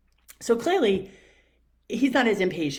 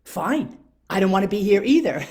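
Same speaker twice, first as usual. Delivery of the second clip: loud, higher snapping clerk; imitating a loud exasperated employee; mock fed-up clerk; 5 semitones higher, 10 dB louder than usual. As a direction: fire back like a clerk who's had enough. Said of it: "And he goes".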